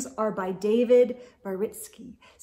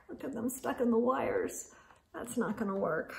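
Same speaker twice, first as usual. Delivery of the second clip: hushed comment; soft aside; 9 dB quieter than usual. Real, not acted. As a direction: drop your voice to point something out. Quiet aside about picture.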